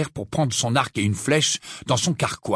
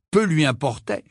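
ordinary delivery

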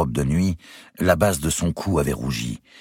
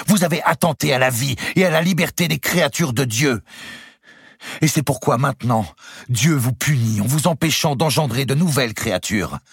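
high, loud indictment; accusing queen; 12 semitones higher, 4 dB louder than usual. A narrator's reading. Clémence accuses Louis of his crimes, stern. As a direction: condemn him outright.

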